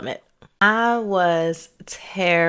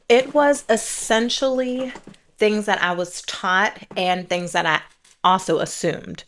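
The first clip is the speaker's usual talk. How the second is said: ordinary talk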